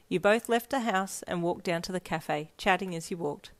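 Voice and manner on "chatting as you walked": ordinary delivery, nothing marked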